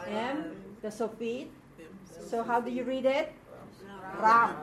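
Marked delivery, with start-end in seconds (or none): 4.2-4.6 Ram with an open "ah" vowel; Hebrew pronunciation of Ram. Shown as a demonstration. Teacher reading out the Hebrew word, Ram said "rahm". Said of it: "not Ram".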